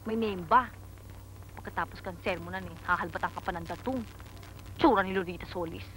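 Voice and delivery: fast grumbling under the breath. Acted. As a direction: mutter the complaint quickly, barely audible. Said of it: "Anong binubulong mo dyan".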